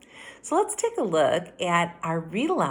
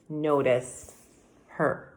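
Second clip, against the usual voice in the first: ordinary delivery, nothing marked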